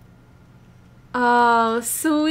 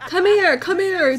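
calling out; 6 semitones higher, 4 dB louder than usual. Real, not acted. Calling the Sim over.

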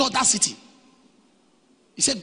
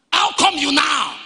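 raised, higher preacher's quote; preached shout; 7 semitones higher, 5 dB louder than usual. Retold or imitated. Preacher voicing the disciples questioning Jesus, preached shout.

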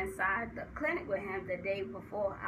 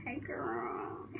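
low whispered, softer hello; hushed greeting; 9 semitones lower, 5 dB quieter than usual. Retold or imitated. Acting staff greeting her familiarly.

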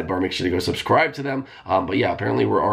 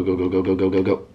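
ordinary talk

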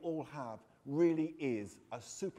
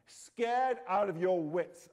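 slow, louder taunt, stretched out; taunting repeat; 3 semitones higher, 7 dB louder, slower than usual. Acted. Narrator taunting Aaron with his own words, slow mocking repeat. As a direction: drag each word to mock him. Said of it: "I repeated".

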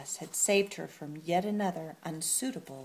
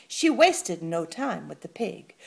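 ordinary delivery

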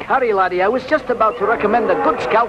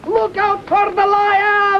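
alarmed call; 11 semitones higher, slower than usual. Acted. Calling out a warning.

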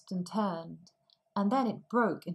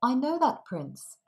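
put-on eager older sister; 6 semitones higher, 6 dB louder than usual. A narrator's reading. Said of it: "her eldest sister said".

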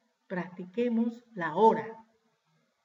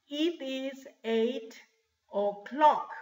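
very slow, with a pause before "o'clock"; slow model answer; 3 semitones higher, much slower than usual. Shown as a demonstration. Teacher answering what time it is, slow model answer. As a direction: give the answer word by word.

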